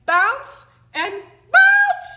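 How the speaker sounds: spring up in pitch on every "bounce"; building bounces. A narrator's reading.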